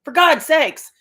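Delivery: loud, high comic outrage; mock exasperation. Daughter telling viewers her mother survived after all, mock exasperated. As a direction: wail it as if survival were a huge inconvenience.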